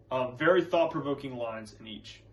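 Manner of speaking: ordinary talk, nothing marked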